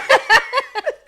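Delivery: high-pitched burst of laughter; real giggle after a mishap. Real, not acted. Laughing at bitten tongue.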